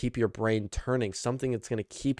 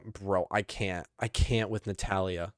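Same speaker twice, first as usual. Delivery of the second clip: ordinary talk, nothing marked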